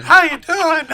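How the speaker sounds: loud, very high put-on voice; piercingly high and loud. Shown as a demonstration. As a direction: demo a high-pitched character voice. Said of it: "I can do a high voice".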